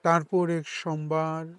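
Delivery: measured poetry reading with long pauses between lines; poem recitation. Acted. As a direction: recite it as a poem, each phrase set apart. Recites own poem after introduction.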